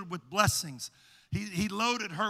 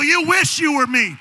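much higher, loud triumphant shout; shouted rebuke; 9 semitones higher, 16 dB louder than usual. Acted. Believer shouting triumph at the devil. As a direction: hurl it at the devil. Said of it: "would tell the devil".

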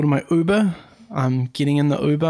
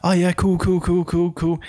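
a touch higher, imitating the driver; lightly voiced driver; 4 semitones higher than usual. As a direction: rattle off the cools as the chatty driver. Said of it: "And he's like".

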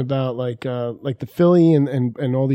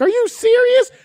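very high, louder disbelieving squeal; high-pitched acted outburst; mock incredulous cry; 5 dB louder than usual. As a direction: imitate a wife shrieking in disbelief. Wife's incredulous reaction, retold.

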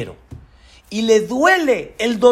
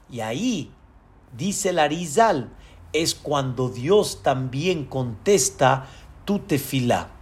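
hushed; 5 semitones lower, 7 dB quieter than usual. Real, not acted. Preacher's hushed key point.

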